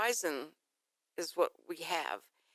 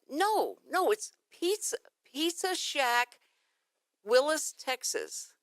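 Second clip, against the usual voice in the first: higher, louder, slow insistent correcting; louder, higher annoyed repeat of Pizza Shack; irritated correction; 6 semitones higher, 4 dB louder, much slower than usual. Retold or imitated. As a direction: spell it out to a dumb machine. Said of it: "And I went".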